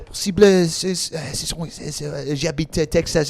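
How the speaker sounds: jabber in fake French as astonished students conferring; mock French chatter. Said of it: "they started speaking French".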